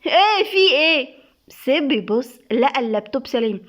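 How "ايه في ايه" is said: high, loud and slow startled cry; wife startled when lifted down. A narrator's reading. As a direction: jump in fright. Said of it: "نور اتفزعت".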